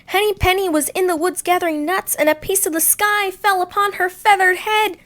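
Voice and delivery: high, loud fretful duck voice; alarmed duck. Acted. Ducky Lucky telling Goosey Lucy the sky is falling, high and fretful. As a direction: spill the news in a fluster.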